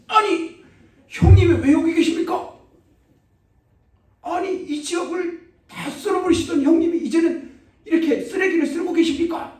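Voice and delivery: high, loud, over-the-top deference from a henchman; hammed-up thug voice, laughing through it; fawning gangster. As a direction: gush at your boss as if he's royalty. Thugs fawn over former boss.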